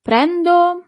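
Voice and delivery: "Prendo" said high and clear, stress on the first syllable; model word. Shown as a demonstration. Teacher introducing the verb "Prendo" to students. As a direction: lift "Prendo" up like a new word to learn. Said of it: "you use the verb prendere".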